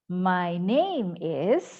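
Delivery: "My name is" very slow, "name" lifted, "is" dropping; "is" falls, drawn out for students to copy; falling intonation. Shown as a demonstration. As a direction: stretch "name", then step down on "is". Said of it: "turun ya".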